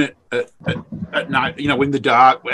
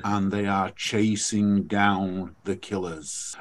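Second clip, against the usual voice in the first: slow and much softer, low and hushed; hushed true-crime podcast host; 6 semitones lower, 9 dB quieter, much slower than usual. Retold or imitated. Runner imitating the podcast hosts, slow and hushed. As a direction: murmur it like a true crime narrator. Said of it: "they talk like this".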